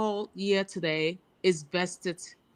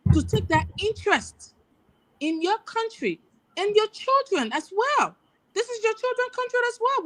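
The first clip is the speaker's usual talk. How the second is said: much higher, rising fervent plea; impassioned appeal; 9 semitones higher than usual. Real, not acted. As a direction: climb higher and higher with conviction. Climax of patriotic appeal.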